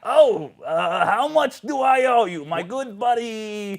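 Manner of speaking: high, loud, phony buddy voice; Adino's impostor. Acted. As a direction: bluff as the fake friend, stretching the end. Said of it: "Has Adino always talked like this".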